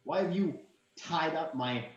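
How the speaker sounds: high, scoffing royal voice; indignant prince. Acted. Prince to the party about his bride, high and scoffing. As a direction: scoff at the ridiculous claim. Prince mocks, then demands answers.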